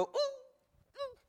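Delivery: very high, cooing mock-cute "ooh". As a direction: coo like a sweet little cartoon angel. Mocking cute little angel.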